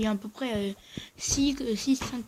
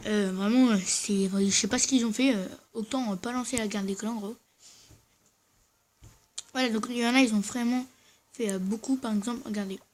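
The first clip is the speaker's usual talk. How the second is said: ordinary talk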